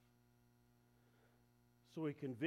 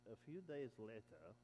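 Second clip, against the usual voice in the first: social media voice; 6 dB quieter than usual. Shown as a demonstration. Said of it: "it will say it like this".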